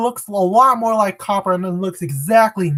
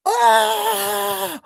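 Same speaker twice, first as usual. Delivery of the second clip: high, drawn-out mock rage scream; hammed-up shriek of fury; Copper's rage scream; 12 semitones higher than usual. Streamer imitates Copper losing it, long high scream. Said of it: "we can talk about Copper raging".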